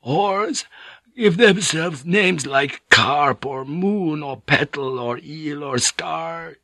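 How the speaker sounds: the monk drifting toward sleep; a hint of the sleepy monk. A narrator's reading. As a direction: friar growing drowsy mid-sentence. Drowsy monk, fading.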